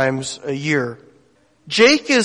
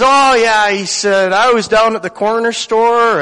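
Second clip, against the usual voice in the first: excited retelling; 6 semitones higher, 5 dB louder than usual. Retold or imitated. [name] excitedly tells his story.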